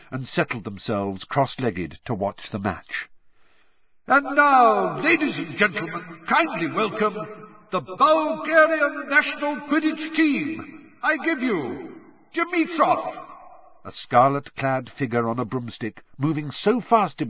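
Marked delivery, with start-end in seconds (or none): 4.1-13.3 stadium announcer. A narrator's reading. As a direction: announce the team to the whole stadium. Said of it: "yelled Bagman".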